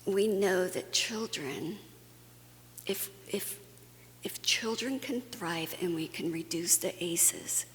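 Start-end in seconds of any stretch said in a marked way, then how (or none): none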